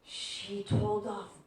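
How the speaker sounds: put-on breathy, laughing wonder of a small boy; amazed little boy. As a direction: whisper it, then laugh in delight.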